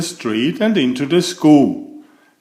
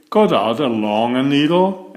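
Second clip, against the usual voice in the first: ordinary delivery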